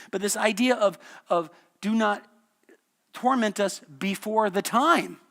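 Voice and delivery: loud, high preaching voice; full-force preaching. Acted. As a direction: drive the point home from the pulpit.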